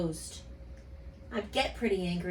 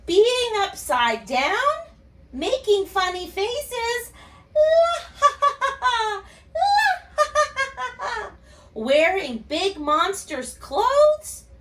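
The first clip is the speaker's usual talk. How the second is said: silly purple monster; 12 semitones higher, 7 dB louder than usual. Acted. Teacher reading to kids as a giggling silly monster. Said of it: "The purple monster says".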